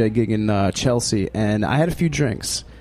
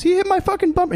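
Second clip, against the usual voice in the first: much higher, squeaky upset yell; mock outraged driver. Storyteller mimics angry driver yelling to cop, high squeaky.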